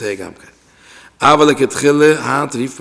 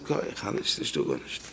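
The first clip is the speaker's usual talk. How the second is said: very soft, nearly voiceless mock excuse; feeble refusal; 10 semitones lower, 14 dB quieter than usual. Man told to say blessings aloud begs off, feebly and very quietly. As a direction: let the voice drop away to a near-whisper. Acting someone too weak to speak up.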